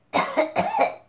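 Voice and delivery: high hammed-up cough into elbow; fake cough. Shown as a demonstration. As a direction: cough it out big for the kids. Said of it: "Do the elephant when you cough".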